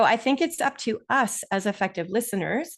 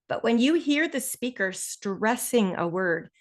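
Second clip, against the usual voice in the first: slow, drawn-out "stressing". Shown as a demonstration. Teacher showing what a stressed word sounds like, emphatic "stressing". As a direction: stretch "stressing" to mark it out. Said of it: "like I just did again".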